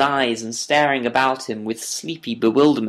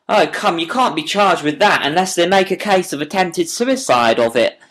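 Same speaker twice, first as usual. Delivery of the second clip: higher, louder and amused; with a grin; 6 semitones higher, 5 dB louder than usual. A narrator's reading. Inspector joking with the prisoner about the charge, grinning. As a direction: tease him with a grin. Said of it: "said the inspector with a grin".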